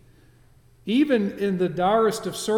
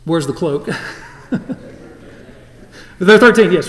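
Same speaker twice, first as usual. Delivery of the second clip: laughing; 2 semitones higher, 16 dB louder than usual. Real, not acted. Laughing while asking.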